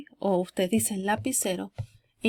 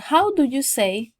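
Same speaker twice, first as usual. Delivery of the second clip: loud, higher teaching model; model for repetition; 4 semitones higher, 7 dB louder than usual. Shown as a demonstration. Teacher recaps phrases for students to repeat, emphatic model. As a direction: project it for learners to repeat. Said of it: "lo repitan de nuevo".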